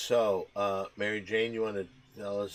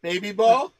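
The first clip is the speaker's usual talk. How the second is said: talking to a dog; 13 semitones higher, 15 dB louder than usual. Real, not acted. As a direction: coax the dog in a loud squeaky voice.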